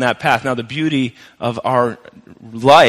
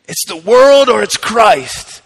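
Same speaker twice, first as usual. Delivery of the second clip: slow, higher, louder revival-preacher cadence; Billy Graham impression; 7 semitones higher, 5 dB louder, slower than usual. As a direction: draw out each phrase like a famous evangelist. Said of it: "that's my best Billy Graham imitation".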